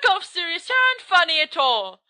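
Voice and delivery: high-pitched irritation; annoyed retort. Acted. Storyteller tells Sirius off for spooking them, high annoyed voice. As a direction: scold the prankster. Scolding the prankster after scare.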